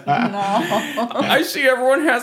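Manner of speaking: high kindergarten teacher. Pretend. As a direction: talk like a preschool teacher. Teacher voice for kids.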